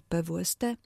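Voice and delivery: P W S T stressed on the last letter, "pe-wu-es-TE"; final-syllable stress. Shown as a demonstration. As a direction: spell P W S T, accenting the final T. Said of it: "Na ostatniej sylabie akcentujemy literowce". (P W S T z akcentem na ostatnią sylabę: pe-wu-es-TE)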